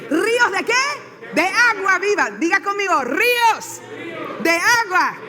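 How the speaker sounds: high, emphatic chant-leader calls; preacher firing up the congregation to repeat; rallying call-and-response. Real, not acted. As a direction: call it out phrase by phrase for the crowd.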